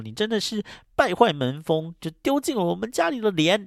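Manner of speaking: a father shaming his daughter; angry scolding. Retold or imitated. Grandfather scolds and disowns daughter.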